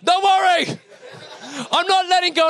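high-pitched, over-eager shout; acted dad yelling a promise. Father calls false reassurance to son.

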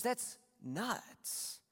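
high, hushed mock disbelief; soft, high-pitched amazement, imitated; incredulous God. God voiced, amazed at Jonah.

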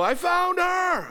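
high, slow proud cry; dad proudly announcing the find; triumphant shout. Retold or imitated. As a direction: announce it like a hero. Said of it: "I go".

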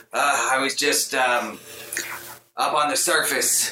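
lightly voiced mischievous imp; sly, playful little imp; impish voice. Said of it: "the familiar impish voice comes".

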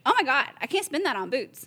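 mock-outraged Jersey accent; attempted Jersey accent. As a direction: imitate an aghast Jersey shopper. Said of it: "I don't know what a Jersey accent is".

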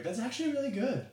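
high, lilting mock-impressed voice; backhanded praise. Co-host humbling a friend after his impression, insincere praise. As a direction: pitch it way up, insincerely surprised. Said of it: "I always say it that exact way".

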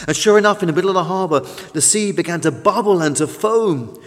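plain reading voice

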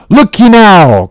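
high, slow, forceful call; cried appeal. A narrator's reading. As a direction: cry out to make the captain listen. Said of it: "cried the Lake Man".

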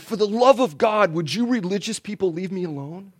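exasperated atheist. Retold or imitated. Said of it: "this is gonna sound a little ironic".

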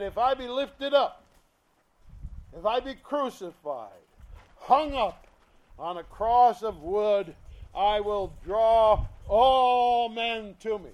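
loud, ringing scripture reading; preaching scripture. Acted. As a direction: proclaim the verse to the congregation. Voices Jesus, forceful.

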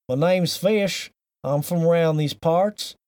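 very slow Southern drawl; Southern accent. Shown as a demonstration. Host shows Fish how to do a Southern accent, slowly. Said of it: "They just say, like".

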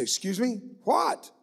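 incredulous, jumps way up on the second what; retelling his own baffled double-take. Retold or imitated. Caller repeats himself to a gruff well digger, incredulous.

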